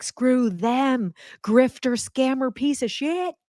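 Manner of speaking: high-pitched mock outrage, drawn out toward the end; mock contempt. Student trashing an old physics teacher, mock contempt.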